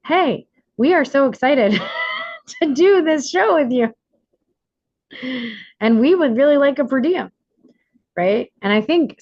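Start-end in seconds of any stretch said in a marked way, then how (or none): 0.0-7.3 higher, louder pretend crying-through-it ask; hammed-up weepy but polite pitch; mock tearful request. Shown as a demonstration. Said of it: "still have tears running down your face".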